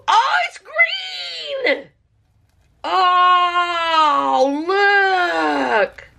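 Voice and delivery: high, drawn-out cry of delight; thrilled at the colour; delighted shout. Real, not acted. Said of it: "I'm so excited".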